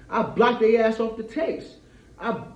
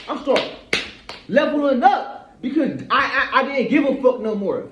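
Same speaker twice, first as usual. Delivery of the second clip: fired-up rant; 5 semitones higher, 5 dB louder than usual. Real, not acted. Heated rant to viewers, laughing.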